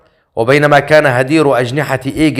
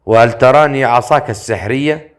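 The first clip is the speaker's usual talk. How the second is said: ordinary delivery, nothing marked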